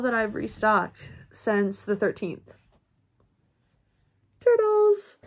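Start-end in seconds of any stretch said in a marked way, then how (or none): 4.4-5.2 high, loud gleeful cry; excited. Real, not acted. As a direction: announce it with delight. Showing off restocked turtles.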